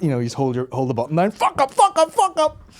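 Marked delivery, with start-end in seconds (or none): 1.3-2.7 very high looping shout; Belfast bouncer. Acted. Bouncer move with button held down, high repeated yell. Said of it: "you'd have the Belfast bouncer".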